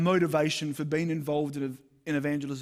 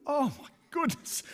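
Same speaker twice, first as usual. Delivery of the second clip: embarrassed exclamation; 10 semitones higher than usual. Real, not acted. Cringing at own blunder.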